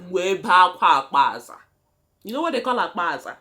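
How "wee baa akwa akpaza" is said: loud hammed-up lament; loud mock cry of grief; mock wail. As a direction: wail it like a mourner, half in jest. Mock wailing lament in Igbo.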